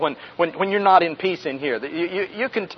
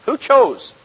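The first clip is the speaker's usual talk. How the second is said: ordinary talk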